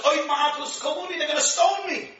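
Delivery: a touch of mock panic; alarmed plea. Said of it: "Moshe comes to Hashem and says".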